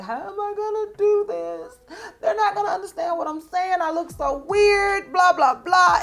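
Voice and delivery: high, teary mock lament; high whiny mock crying; imitating her crying self. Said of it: "just start crying".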